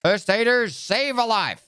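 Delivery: high, sing-song drill chant; army drill call. Retold or imitated. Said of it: "Every time we had, like".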